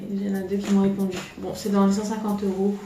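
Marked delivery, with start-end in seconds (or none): none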